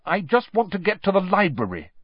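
high-pitched, dignified explanation; prim self-justification; strained dignity. A narrator's reading. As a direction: explain yourself with mustered dignity. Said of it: "with as much dignity as she could muster".

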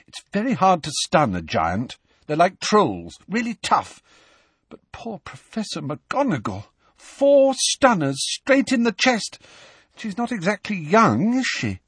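upset, rising into high distress; high-voiced worry for someone hurt; shaky. A narrator's reading. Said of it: "said Hermione shakily".

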